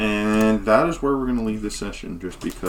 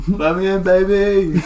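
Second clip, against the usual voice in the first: pleading rocker; 11 semitones higher, 6 dB louder than usual. Acted. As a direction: croon it at the gate. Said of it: "continuing bard voice".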